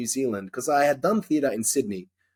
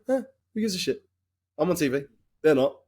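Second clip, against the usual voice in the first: higher-pitched, dismissive retold retort; 3 semitones higher, faster than usual. Actor retelling his answer to online critics, dismissive. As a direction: brush off the critics.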